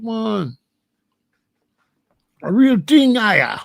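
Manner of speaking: animated Jamaican patois with high bursts; Jamaican accent. Shown as a demonstration. Showing off the yard thing to listeners, Jamaican accent. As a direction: show off thick Jamaican yard talk. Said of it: "I can do it".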